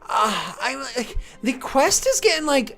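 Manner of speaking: high, drawn-out whiny kobold voice. Acted. Kobold whining at the party to keep going. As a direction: whine at the party to hurry up.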